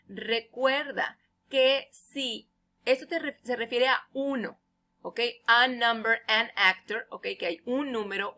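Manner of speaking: ordinary delivery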